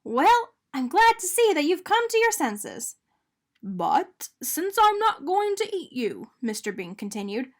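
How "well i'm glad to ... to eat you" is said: high voiced donut Arnie. A narrator's reading.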